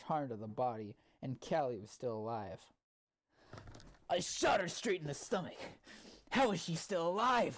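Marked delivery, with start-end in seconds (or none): 4.1-7.6 louder, higher, incredulous gunman; hammed-up villain asking his boss, loud; loud demand. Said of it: "Hunter asked the Prowler loudly".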